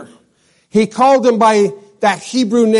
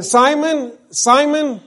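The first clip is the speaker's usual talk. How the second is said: higher pitched call of a name, repeated; calling name urgently; 6 semitones higher, slower than usual. Acted. Jesus calling Simon to attention, higher urgent call. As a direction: call him by name to get his attention. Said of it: "Jesus is saying".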